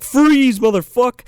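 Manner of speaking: high, loud bark of a cop busting in; mock cop shout. Cop kicking down the door, yelling at the knitter: high loud shout. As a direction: yell freeze like a movie cop. Cop bursts in, gun drawn.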